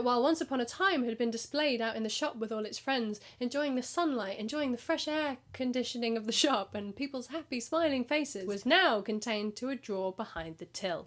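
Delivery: usual speaking voice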